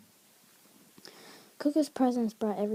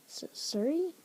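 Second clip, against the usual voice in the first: awkward; 8 semitones higher than usual. A narrator's reading. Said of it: "Coco asked awkwardly".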